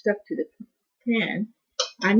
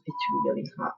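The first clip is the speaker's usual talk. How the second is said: whispered; 8 dB quieter, faster than usual. Real, not acted. Tasting hot food, whisper.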